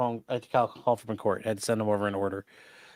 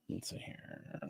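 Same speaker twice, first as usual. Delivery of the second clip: whispered; 21 dB quieter, slower than usual. Real, not acted. Streamer muttering to himself.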